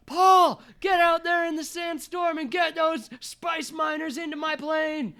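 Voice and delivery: high, loud shouted order; yelling. Acted. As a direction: yell it over the sandstorm. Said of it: "he yells to his son".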